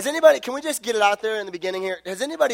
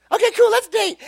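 put-on chirpy girl voice; 4 dB louder than usual. Voicing Ferret Girl agreeing.

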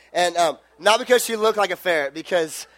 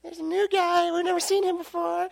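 put-on high, breathless girly gush; mock swooning girl voice; 11 semitones higher, 5 dB quieter than usual. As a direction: imitate excited church girls spotting a new guy. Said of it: "all the girls are like".

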